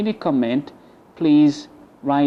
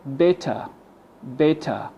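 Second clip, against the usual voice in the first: British "better", no R at the end; British pronunciation. Shown as a demonstration. Teacher asks to listen to the British one, British "better". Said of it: "just listen to the British one".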